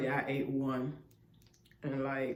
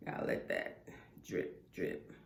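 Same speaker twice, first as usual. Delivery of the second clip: whispering; 13 dB quieter than usual. Real, not acted. Eating, close to the mic.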